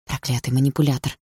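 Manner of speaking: ordinary delivery, nothing marked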